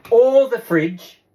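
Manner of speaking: usual speaking voice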